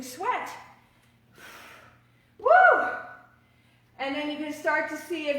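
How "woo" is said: high, loud cheer of effort; excited whoop. Real, not acted. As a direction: let out a big whoop. Instructor cheers mid-workout.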